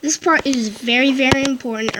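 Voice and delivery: normal talking voice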